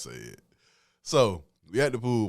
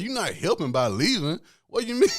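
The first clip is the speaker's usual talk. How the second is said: higher-pitched retold objection, climbing at the end; protesting; 9 semitones higher than usual. Man arguing with the woman leaving, protesting.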